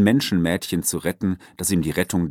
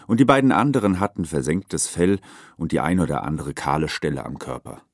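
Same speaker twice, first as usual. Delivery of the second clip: ordinary delivery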